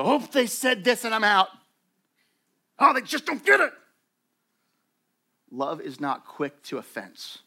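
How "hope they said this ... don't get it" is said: higher, louder huffy mock voice; mock offended person. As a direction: imitate someone storming out offended. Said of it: "It doesn't go".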